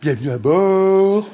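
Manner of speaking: put-on slow, prim cabin-crew welcome with no regional accent; slow, refined flight-attendant welcome; polished steward greeting. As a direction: put on the proper airline voice. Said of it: "j'ai gommé mon accent, je parle bien, je fais".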